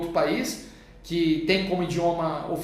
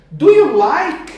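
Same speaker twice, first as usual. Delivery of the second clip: slow modeling; 7 semitones higher, 9 dB louder, slower than usual. Shown as a demonstration. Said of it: "teacher models English question".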